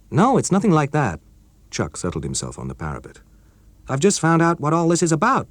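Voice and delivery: put-on keen young colleague breaking news; character voice of Chuck, eager; Chuck, young American engineer. Chuck's voice in story.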